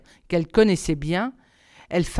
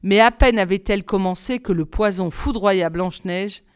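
ordinary delivery

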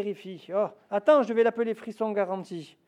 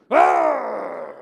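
a sudden loud boo shout; wolf's scare roar; 7 dB louder than usual. Acted. The big bad wolf jumps out to scare the mouse, roaring. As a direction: leap out and roar at her.

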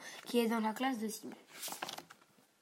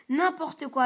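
louder, cheeky dismissal; defiant retort; 4 semitones higher, 6 dB louder than usual. A narrator's reading. Simon snubs his father, loud and cheeky. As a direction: brush off father's plea.

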